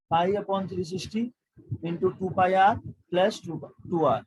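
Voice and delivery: slow, each term set apart; dictation pace. Shown as a demonstration. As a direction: read the formula out slowly for notes. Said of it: "बार बार questions करोगे". (धीरे धीरे formula बोलना)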